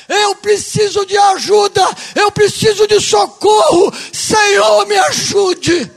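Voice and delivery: high, loud cry for help, building phrase by phrase; shouted plea. Acted. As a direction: plead at full force, as in fervent prayer. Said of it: "pra dizer". (súplica gritada, fervorosa)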